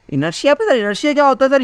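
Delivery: high-pitched mock student question. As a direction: play a pupil puzzled by a new term. Teacher voices students asking about inertia.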